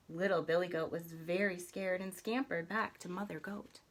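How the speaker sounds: ordinary delivery, nothing marked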